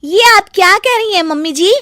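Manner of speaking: high, alarmed disbelief; startled. Acted. Daughter-in-law hears mother-in-law's ghost talk, startled.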